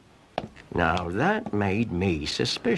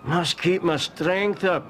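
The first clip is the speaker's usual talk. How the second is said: higher, slow, hammed-up weakness; mock feeble; 7 semitones higher, slower than usual. Cromarty angling for a jam tart, mock feeble. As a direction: play the brave invalid.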